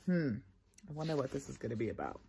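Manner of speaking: usual speaking voice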